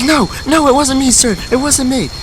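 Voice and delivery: very high panicked squeal; squeaky, pleading panic, hammed up; mock freak-out. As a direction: imitate a kid frantically denying blame. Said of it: "the Glick starts freaking out".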